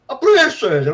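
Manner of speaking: high, loud astonished question. Retold or imitated. Adviser reading the form, high and astonished. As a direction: gasp out the question.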